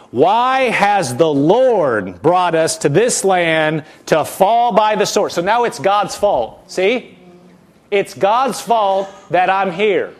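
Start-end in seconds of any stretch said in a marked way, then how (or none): 0.1-5.3 slow, drawn-out accusing question. Retold or imitated. Preacher voicing Israel accusing God, slow and accusing. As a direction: stretch each word out like an accusation. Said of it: "This is the voice of the accuser".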